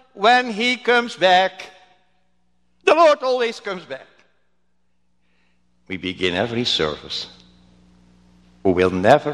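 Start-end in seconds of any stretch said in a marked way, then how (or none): 0.1-4.1 high, measured call back across a distance; pastor crying out. Retold or imitated. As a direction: call the answer out in a raised pitch. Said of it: "then the pastor cried".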